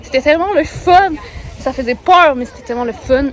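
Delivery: loud and breathless; thrilled, calling out; excited. Real, not acted. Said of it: "essoufflée".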